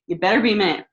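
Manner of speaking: firm loud demand, stress on be. Retold or imitated. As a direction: raise the voice on the warning to the husband. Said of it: "so I was like".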